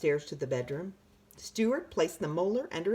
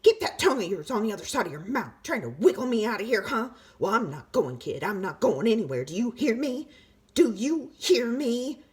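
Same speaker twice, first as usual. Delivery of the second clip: fast, louder bossy taunt; taunting tooth; 5 semitones higher, 5 dB louder than usual. A narrator's reading. Tooth defying the boy from inside his mouth, bossy and fast. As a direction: needle the kid and dare him.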